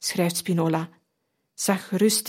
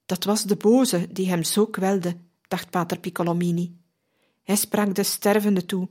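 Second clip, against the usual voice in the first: plain reading voice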